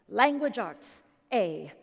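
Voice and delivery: imitating a British father reading out grades; British accent. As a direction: put on a British dad's accent, each word set apart. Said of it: "allowed to make that accent 'cause he actually is British".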